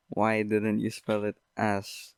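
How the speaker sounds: ordinary talk, nothing marked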